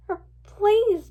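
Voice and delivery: high, loud plea; begging. A narrator's reading. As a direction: beg a guard to relent. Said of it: "begged Twilight".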